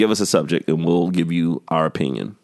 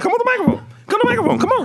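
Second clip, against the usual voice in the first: high coaxing call; much faster than usual. Real, not acted. Coaxing guest to the mic.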